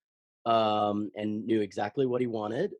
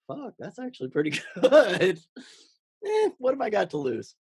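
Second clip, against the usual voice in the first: much higher and louder, laughing through the words; surprised laughing delight; 10 semitones higher, 7 dB louder than usual. Retold or imitated. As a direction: retell the delighted surprise at your own take, laughing. Retold pleased self-talk on playback.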